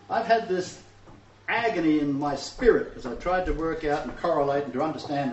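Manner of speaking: usual speaking voice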